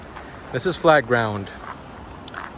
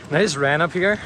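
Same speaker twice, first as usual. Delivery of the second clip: normal talking voice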